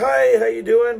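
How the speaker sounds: chirpy doctor greeting. Retold or imitated. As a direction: greet like an over-cheerful doctor. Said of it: "She's like".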